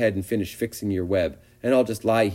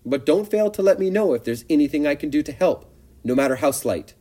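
higher, louder, eager little pig; eager young pig; 7 semitones higher, 4 dB louder than usual. A narrator's reading. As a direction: pester her, trembling with hope. Wilbur's eager offer.

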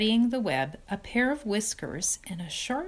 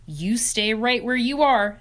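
firm mother; 4 semitones higher, 7 dB louder than usual. Acted. Mother refusing child.

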